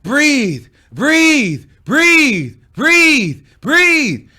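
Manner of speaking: loud, high, insistent orders; hammed-up shouted coaching, each word falling; mock breathing coach. Imitates friend coaching breathing.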